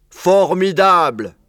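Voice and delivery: slow, loud drawn-out cheer; high, booming elongated exclamation; yelling. A narrator's reading. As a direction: holler it out in delight. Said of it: "hurla Blaireau".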